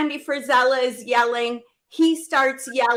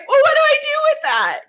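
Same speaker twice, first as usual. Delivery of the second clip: very high, helpless question; lost and pleading for an answer; distressed plea; 8 dB louder, faster than usual. Retold or imitated. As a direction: voice jumping up in distress. Her retold plea to the coach.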